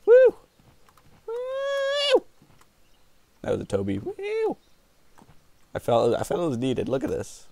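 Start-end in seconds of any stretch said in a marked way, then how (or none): 0.1-2.2 excited whoop. Real, not acted. Cheering jump. 4.0-4.6 excited whoop. Real, not acted. Cheering jump.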